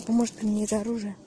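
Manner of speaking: usual speaking voice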